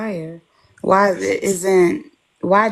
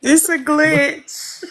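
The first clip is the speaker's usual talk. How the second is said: laughing; 8 semitones higher, much slower than usual. Real, not acted. Laughing repeat.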